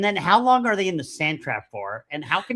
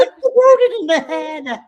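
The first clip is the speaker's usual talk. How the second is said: mock outraged squeal; 12 semitones higher, 10 dB louder than usual. Mocking Buttercup's inaction.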